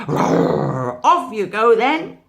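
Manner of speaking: loud playful roar; hammed-up tiger roar; mock roar. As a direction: roar it like a pretend chase. Tiger pretending to chase.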